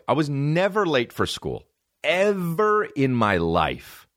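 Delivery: "never" and "Ever" pitched high and stretched; emphatic insistence. Real, not acted. Setting the record straight on lateness, high "never", drawn-out "Ever". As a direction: insist hard, drawing out "Ever".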